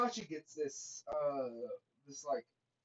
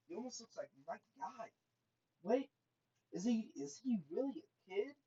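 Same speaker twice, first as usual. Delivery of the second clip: higher-pitched, puzzled disbelief; taken aback; 5 semitones higher than usual. Retold or imitated. Itachi puzzling over grown-looking Naruto, taken aback. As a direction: question it in hushed, rising bafflement.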